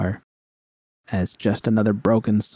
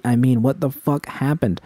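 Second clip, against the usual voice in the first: usual speaking voice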